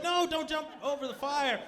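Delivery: urgent mock plea to stop; alarmed warning. Friends try to stop the groom, alarmed.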